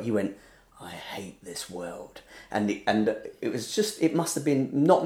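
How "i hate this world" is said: slow, very soft mock loathing. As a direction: utter it quietly and slowly, imitating a weary hater. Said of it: "the hate I felt".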